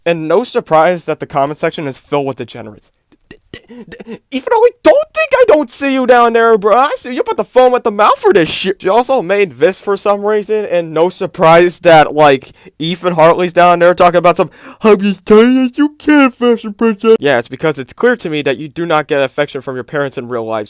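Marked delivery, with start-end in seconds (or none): 3.7-8.8 teasing jab. Real, not acted. Teasing commenter. 14.8-17.2 mock lovey read. Mocking Ethan's line.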